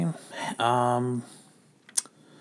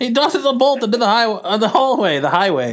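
put-on old-timey narrator; 8 semitones higher, 3 dB louder than usual. A narrator's reading. Narrates the comic.

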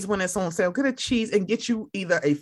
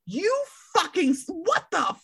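high, slow, disgusted exclamation; mock outrage; 11 semitones higher than usual. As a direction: act scandalized at the grits. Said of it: "I'm like".

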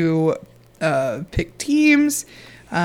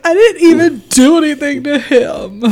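high, whiny imitation of crying protest; mock crying; 7 semitones higher than usual. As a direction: imitate a tearful plea of innocence. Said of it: "she cries and be like".

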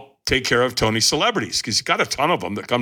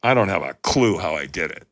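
ordinary delivery

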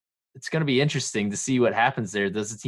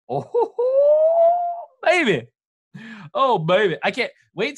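excited hype; 13 semitones higher, 5 dB louder than usual. Real, not acted. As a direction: hype it up, loud and high. Excited hype about upcoming guest.

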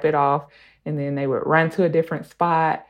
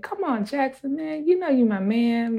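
coaxing barber; 9 semitones higher than usual. Retold or imitated. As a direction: sweet-talk a reluctant little boy into the chair. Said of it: "And Ree is like".

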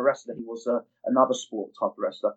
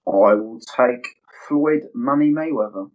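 dramatic announcement; 4 dB louder, slower than usual. Real, not acted. Slow dramatic quiz answer.

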